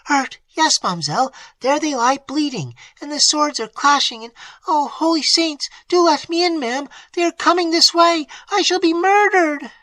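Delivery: high maid building from alarm to loud terror; rising panic. A narrator's reading. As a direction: beg to be let in as danger nears.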